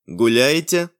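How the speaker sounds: machine-like questioning; flat police robot query; metallic robot voice. A narrator's reading.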